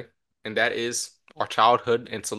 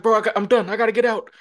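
higher, louder mock panic; a scared kid wanting out of the car, played up; panicked plea; 10 semitones higher, 5 dB louder, faster than usual. Panicking teen driver begs to stop.

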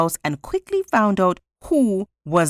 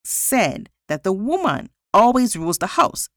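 raised pitch, emphatic assertion; very certain; 8 semitones higher than usual. A narrator's reading. Jose stating his side to the judge, with great certainty. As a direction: state it with total conviction. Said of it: "said Jose with great certainty".

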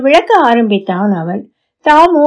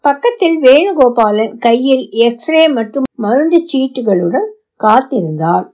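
plain reading voice

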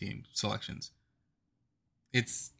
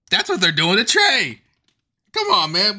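louder and higher, fed up with the haters; exasperated loud outburst; 10 semitones higher, 9 dB louder than usual. Real, not acted. Fan venting at teenage haters, loud and exasperated. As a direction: throw it out hot, come on man. Fired up defending his player.

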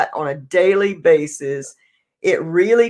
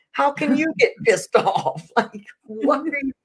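higher, incredulous scolding of her son; mock indignant; 7 semitones higher than usual. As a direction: act outraged at him, then trail off. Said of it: "I had the nerve to be pissed off".

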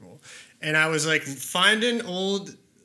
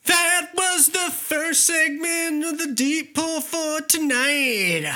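announcer voice; 11 semitones higher, 4 dB louder, slower than usual. Acted. Host announcing next segment.

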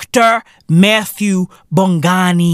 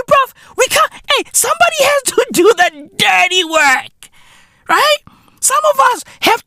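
high comic yelling; squeaky mock shout; 14 semitones higher than usual. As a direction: shriek it like a cartoon tough guy. Mock boast, ranting about blue collar.

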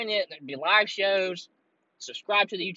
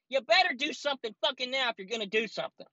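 ordinary delivery

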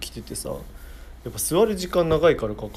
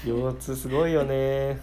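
office lady chat; 7 dB quieter than usual. Pretend. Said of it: "なんかオーエルランチ会話みたいな。".

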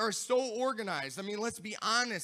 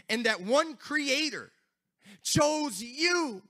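building from a warm talk into louder preaching, a little higher; rising preaching; 7 semitones higher, 8 dB louder, slower than usual. Acted. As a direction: start to lift the sermon toward a climax. Preaching to viewers.

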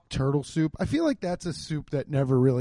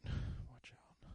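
breathy unvoiced whisper; 19 dB quieter than usual. Real, not acted. Aside to the listeners during the Siri mishap, whispered. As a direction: whisper it under your breath. Aside while phone fails.

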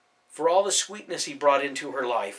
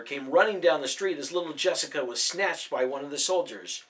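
ordinary delivery, nothing marked

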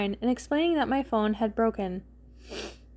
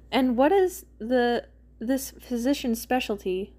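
carefully neutral. A narrator's reading. Mike asks Ginny about her doctor, carefully neutral. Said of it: "careful to keep his tone neutral".

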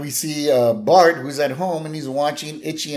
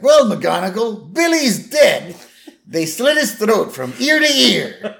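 high, loud, drawn-out mock bellow; hammed-up slow, loud rant; angry police chief; 10 semitones higher, 5 dB louder, slower than usual. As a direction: chew out a cop like a movie chief. Chief berates cop in acted scene.

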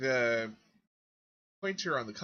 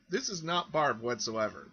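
usual speaking voice

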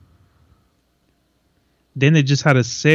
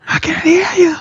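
high mocking voice. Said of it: "imitating the fighter's line".

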